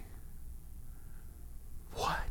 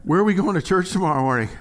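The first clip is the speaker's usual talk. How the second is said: imitating daughter; 7 semitones higher, 4 dB louder, faster than usual. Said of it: "What does my daughter say".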